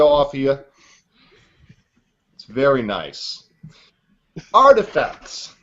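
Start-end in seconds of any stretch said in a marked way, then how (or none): 4.5-5.5 slowed for a listener. Pretend. [name] slowly explaining artifacts to Alex. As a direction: spell it out slowly, as if to a child. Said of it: "Did I slow down for you".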